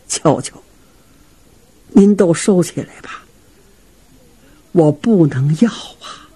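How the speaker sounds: gently turning down the gift; humble refusal. Acted.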